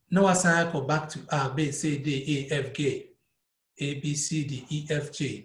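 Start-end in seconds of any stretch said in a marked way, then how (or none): 1.3-3.1 letters said the Dutch way, ah, bay, say, day; Dutch letter names. Shown as a demonstration. Music teacher showing how Dutch names the notes, Dutch pronunciation of "A, B, C, D, E, F, G". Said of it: "And then Dutch, we say".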